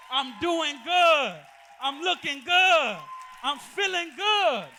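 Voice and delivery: testifying to the church, slow and loud; triumphant testimony. Acted. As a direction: build it up like a preacher's victory list.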